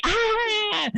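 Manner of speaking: high, drawn-out mock laugh squeal; one long forced high "ah"; fake laugh. Shown as a demonstration. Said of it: "I can do that too".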